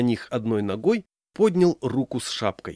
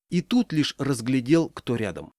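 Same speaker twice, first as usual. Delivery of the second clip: ordinary delivery, nothing marked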